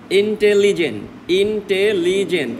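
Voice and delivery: ইন্টেলিজেন্ট broken into four separate syllables. Shown as a demonstration. Teacher counting syllables aloud, ইন্টেলিজেন্ট said part by part. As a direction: spell out the four beats of ইন্টেলিজেন্ট. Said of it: "চারবার, মানে চারটা syllable".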